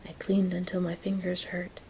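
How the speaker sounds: plain reading voice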